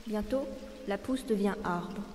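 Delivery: normal talking voice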